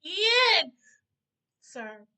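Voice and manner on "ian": Ian yelled out, high and very loud; excited shout. Real, not acted. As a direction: call his name across the room in delight. Calling out to the singer.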